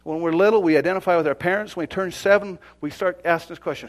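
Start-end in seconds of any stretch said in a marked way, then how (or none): none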